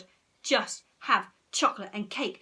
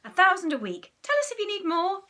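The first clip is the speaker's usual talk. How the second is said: mum smiling; 9 semitones higher, 6 dB louder than usual. Acted. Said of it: "Mum would smile".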